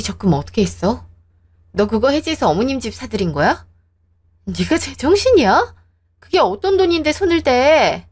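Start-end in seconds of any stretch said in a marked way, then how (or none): 4.4-8.1 higher, rising angry yell; angry outburst. Acted. Wife scolding husband over their savings, higher angry outburst. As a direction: let the fury break through.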